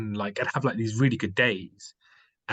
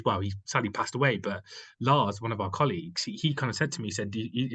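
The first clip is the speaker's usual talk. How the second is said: ordinary delivery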